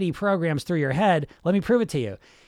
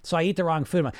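usual speaking voice

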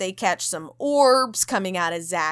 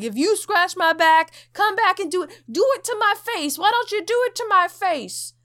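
higher, hammed-up angry dare; annoyed challenge to ghost; mock pissed taunt; 9 semitones higher, 3 dB louder than usual. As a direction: taunt the ghost like a brash bro. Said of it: "Zach is still pissed".

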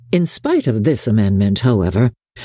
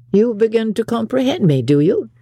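high-pitched, surprised teasing question; exclaimed question; 9 semitones higher than usual. A narrator's reading. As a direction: cry it out as a lifted query. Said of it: "cried he, turning towards her".

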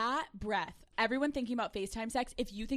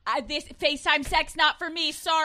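higher, louder mock dismissive voice; mock man's brush-off; 5 semitones higher, 9 dB louder than usual. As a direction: toss it off like a bored guy. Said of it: "I don't see a man being like".

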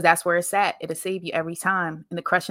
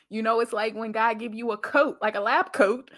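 ordinary delivery

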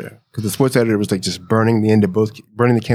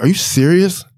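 retold surprise; 7 semitones higher, 3 dB louder than usual. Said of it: "And I was like".

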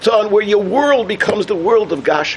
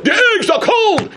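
higher, laughing mock yell; mock complaint; 13 semitones higher than usual. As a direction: pile on the gripe, higher and laughing. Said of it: "the next guy says".

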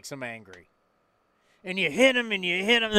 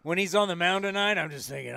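ordinary talk, nothing marked

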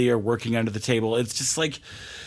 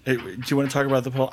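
usual speaking voice

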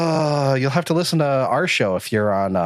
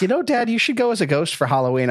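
son's quote pitched higher than dad's own voice; imitated son; 8 semitones higher than usual. Son pitching a costume idea to Dad, lightly voiced higher. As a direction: lightly voice your kid making a suggestion. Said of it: "My son is like".

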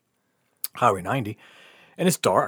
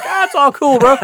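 laughing; 13 semitones higher, 8 dB louder than usual. Retold or imitated. Laughing goodbye to host.

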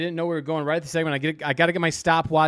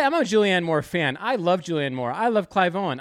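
normal talking voice

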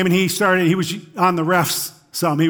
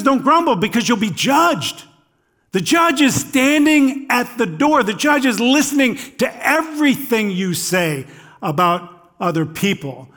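higher-pitched, driving sermon delivery; emphatic preaching; 5 semitones higher than usual. Acted. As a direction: preach the warning with rising urgency.

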